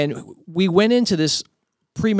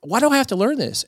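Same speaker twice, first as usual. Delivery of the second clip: frustrated daughter; 7 semitones higher than usual. Retold or imitated. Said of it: "And she's all frustrated".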